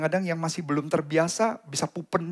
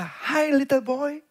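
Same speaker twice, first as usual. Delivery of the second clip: stranger beckoning; 7 semitones higher, slower than usual. Retold or imitated. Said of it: "ada yang berkata".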